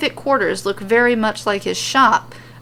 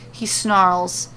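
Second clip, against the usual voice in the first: plain reading voice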